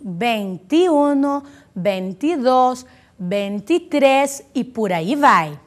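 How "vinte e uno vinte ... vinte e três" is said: slow, drawn-out Spanish numerals linked by an ee sound; stretched teacher's demo, "e" pronounced i; clear I in middle. Shown as a demonstration. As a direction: model "vinte e dos" as benti-dos, very slowly. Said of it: "são escritos com I".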